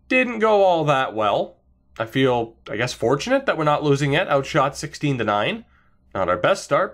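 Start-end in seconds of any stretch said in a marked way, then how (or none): none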